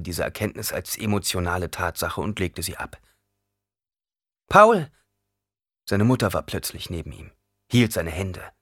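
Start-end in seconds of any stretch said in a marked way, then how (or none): 4.5-4.9 high, loud cry to her son; startled, urgent shout; alarmed call. A narrator's reading. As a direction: call out his name in alarm. Mother calls out in alarm.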